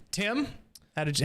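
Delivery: usual speaking voice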